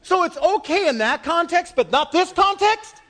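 high-pitched, indignant preaching; incredulous challenge. Real, not acted. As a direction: challenge the congregation on a double standard. Challenges congregation on double standard.

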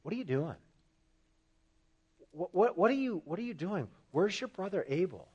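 soft, hushed questioning; a gentle, quiet asking as God; still small voice. Retold or imitated. God gently calling to Cain, in a still small voice. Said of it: "in a very still, small voice".

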